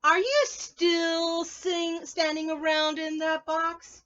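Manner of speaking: slow, high and loud; loud storybook question. Acted. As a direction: ask it slowly and loudly. Reading question aloud.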